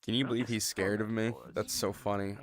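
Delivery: ordinary talk, nothing marked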